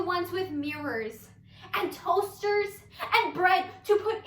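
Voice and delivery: high, loud storytelling broken by laughter; giddy, unhinged monologue character. Acted. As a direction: crack up as you tell it.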